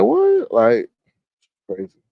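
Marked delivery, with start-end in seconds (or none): 0.0-0.5 shocked what. Retold or imitated. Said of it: "You like".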